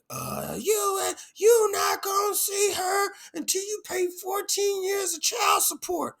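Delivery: shrill high mocking voice, shouted; mocking baby mama. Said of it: "and was like".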